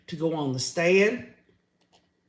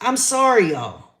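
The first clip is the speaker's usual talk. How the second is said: usual speaking voice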